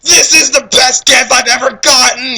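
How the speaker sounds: high, loud, slow gush of joy; overjoyed kid gushing over a gift; hammed-up delight; gushing thanks. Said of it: "he said".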